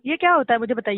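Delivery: usual speaking voice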